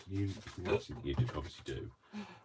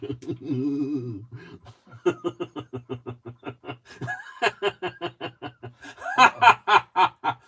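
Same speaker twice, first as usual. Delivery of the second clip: loud villain cackle; global domination laugh; 6 semitones higher, 9 dB louder than usual. Acted. [name] cackling after George's line, villain laugh. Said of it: "my global domination laugh".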